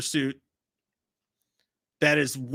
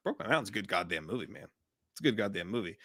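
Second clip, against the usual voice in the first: much softer, low, sincere; hushed praise; 9 semitones lower, 10 dB quieter, faster than usual. Real, not acted. Praising Brokeback Mountain to the audience, hushed and sincere. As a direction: say it quietly, like you mean it.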